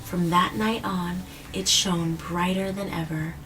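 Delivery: put-on gentle storybook reading to a child; story narration. A narrator's reading. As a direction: read it like a bedtime story, easing down at the end. Reading picture book to child.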